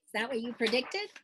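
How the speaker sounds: ordinary delivery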